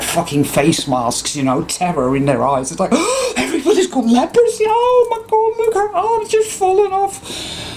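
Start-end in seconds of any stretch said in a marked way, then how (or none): 2.7-2.9 a high, hammed-up gasp of horror; mock shocked gasp. Mocking panicked shoppers. 2.9-7.7 very high, hammed-up terrified shriek voice; mock panic. Mocking panicked shoppers.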